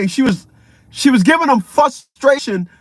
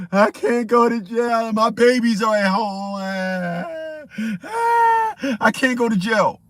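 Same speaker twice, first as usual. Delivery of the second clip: mock pleading; slower than usual. Tackled woman begging.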